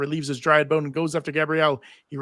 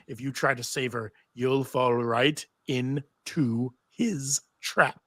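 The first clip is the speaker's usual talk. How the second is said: slow, heavy voice of Orpheus's severed head; slow ominous head; slower than usual. Acted. Narrator voicing Orpheus to Xena, slow and ominous. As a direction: drag each word out like a scheming head.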